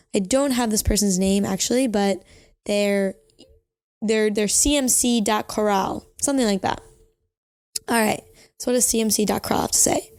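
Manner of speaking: ordinary delivery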